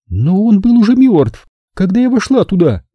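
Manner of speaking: plain reading voice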